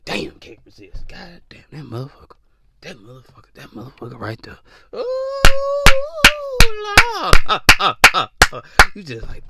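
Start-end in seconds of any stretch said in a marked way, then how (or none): 0.0-4.6 low, half-whispered swooning; breathy lustful mutter. Acted. Acting out women overcome by attraction. 4.9-8.6 high, loud "Lord" then punchy grunts; exaggerated cries of mock ecstasy; mock swooning moans. Acting out women overcome by desire.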